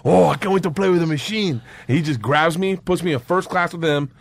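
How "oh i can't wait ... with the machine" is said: mildly gleeful, higher voice; kid on Christmas. Retold or imitated. Gangster meeting the machine, mock childlike glee. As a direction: lightly imitate a gangster's childlike excitement. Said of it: "looks like a kid on Christmas".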